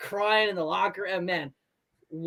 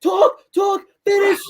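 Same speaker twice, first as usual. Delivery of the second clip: much higher, loud shouting of orders; coach yelling; 12 semitones higher, 8 dB louder than usual. Retold or imitated. Imitating teammate Kevin yelling at the fellas to finish. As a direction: bark it at the players like a court-side yell. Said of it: "I'm screaming the same".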